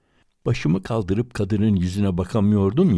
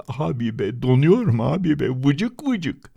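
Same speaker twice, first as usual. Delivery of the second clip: put-on whining freezing boy; 7 semitones higher than usual.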